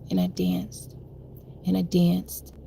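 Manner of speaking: soft, low near-whisper; hushed. Real, not acted. Confessing her survival to viewers, hushed. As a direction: breathe it out, intense and low. Dramatic repeated I danced.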